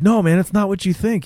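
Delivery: high, hurried-sounding mock pleading; defensive protest. Accused man to the angry father, mock defensive.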